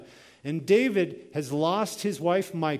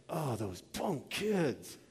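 slow, soft mock grumble of anger; retold anger; 7 dB quieter, much slower than usual. Homeowner fuming at kids who took his keys, retold anger. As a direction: grumble it slowly, holding the anger in. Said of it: "I had genuine anger in my heart".

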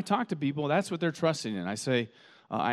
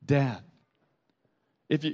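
ordinary delivery

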